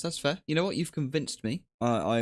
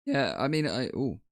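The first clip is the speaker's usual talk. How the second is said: voice crack; 5 semitones higher than usual. Real, not acted. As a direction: voice cracking up high mid-word. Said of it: "Voice crack".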